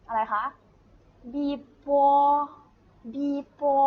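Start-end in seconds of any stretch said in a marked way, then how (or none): 1.3-3.8 บีบัว high and level, last vowel held; letter name, then a long "bo" in บีบัว; demonstrating a pinyin sound. Shown as a demonstration. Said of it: "ออกเสียงยังไงบ้าง".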